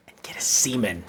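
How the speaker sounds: unvoiced conspiratorial whisper; whispering it like a dirty secret; whispered aside. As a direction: drop to a hush for the punchline.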